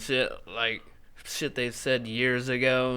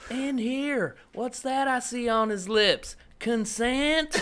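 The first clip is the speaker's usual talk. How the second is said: much higher, laughing; comedian impression; 12 semitones higher, slower than usual. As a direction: squeal the punchline, laughing. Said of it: "I can't do it as good as him".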